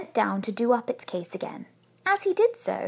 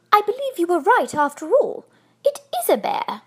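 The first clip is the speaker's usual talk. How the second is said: higher, louder, amazed wife; exclaiming; 8 semitones higher, 5 dB louder than usual. A narrator's reading. Wife telling her husband it is a bear, exclaiming. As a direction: sound astonished by the discovery. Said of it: "she exclaimed".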